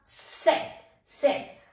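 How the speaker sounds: सेट said with a sharp, unvoiced ts sound; German Z. Shown as a demonstration. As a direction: hit the hissing start of सेट. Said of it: "जेड को सेट बोलते हैं". (German Z को सेट बोलें, स की तेज़ आवाज़ के साथ)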